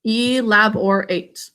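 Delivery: ELABORATE slowly, every syllable full, E-LAB-OR-ATE; sounded out. Shown as a demonstration. Teacher dictates to pupils writing, ELABORATE slow in clear syllables. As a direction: draw ELABORATE out for spelling. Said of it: "Sound it out with me".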